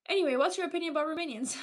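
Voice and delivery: normal talking voice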